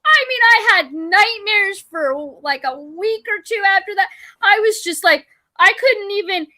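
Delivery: lingering upset in the voice; still shaken. Real, not acted. Telling a friend about nightmares, still shaken and high. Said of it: "a state of shock".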